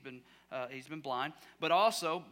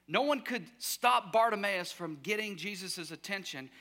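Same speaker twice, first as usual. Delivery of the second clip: ordinary talk, nothing marked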